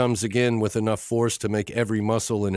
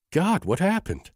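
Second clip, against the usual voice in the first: startled; 10 semitones higher than usual. A narrator's reading. Door swings open, startled.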